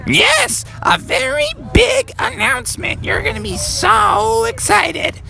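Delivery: very high falsetto mom; squeaky-high mother, words drawn out at the end; Froggy's mom. Acted. Mom promises Froggy big news, high excited mom voice. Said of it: "And Froggy's mom said".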